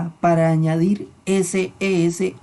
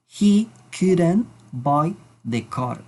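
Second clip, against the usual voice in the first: even slower, deliberate repeat; much slower than usual. Shown as a demonstration. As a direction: repeat it very slowly. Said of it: "Vamos a decir".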